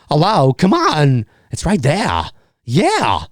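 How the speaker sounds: slow, halting Walken cadence, lightly voiced; Christopher Walken. Shown as a demonstration. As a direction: drag out each phrase like Walken. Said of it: "Christopher Walken impression".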